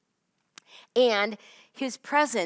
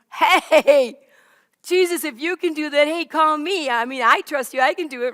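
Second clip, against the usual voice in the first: loud, eager shout across the water; eager loud call; 4 semitones higher, 8 dB louder than usual. Acted. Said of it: "Peter's like".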